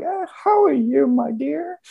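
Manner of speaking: very high, creaky old lady impression; ancient lady. Receptionist at the front desk greeting a newcomer, ancient lady. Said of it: "hundred and forty-year-old lady sitting".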